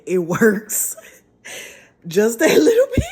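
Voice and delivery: loud laughing and crying; overwhelmed laugh-cry from the steam; laughing excited. Real, not acted. Menthol steam clears her nose.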